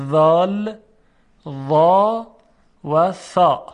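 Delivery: ذال، وا، ثا loud and spaced out, tongue tip at the teeth; careful letter sounds. Shown as a demonstration. Teacher repeating the three Arabic letters, careful articulation.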